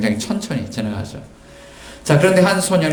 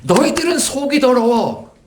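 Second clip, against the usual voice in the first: high, slow, drawn-out rebuke; acted stern reproof; 예수님; 12 semitones higher, slower than usual. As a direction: denounce them with high, stretched words. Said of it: "이렇게 말씀하시는 거예요".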